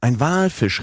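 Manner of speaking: high, excited call of the whale sighting. A narrator's reading. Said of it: "rief darauf der Professor". (aufgeregter Ausruf, höher)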